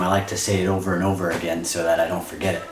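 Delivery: usual speaking voice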